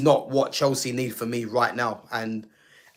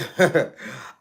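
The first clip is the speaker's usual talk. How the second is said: suppressed chuckle; stifled laugh. Real, not acted. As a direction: laugh you're trying to hold back. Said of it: "I'm trying not to laugh".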